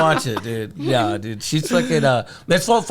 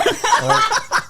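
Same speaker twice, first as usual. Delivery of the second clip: mock innocent. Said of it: "I didn't mean to say that".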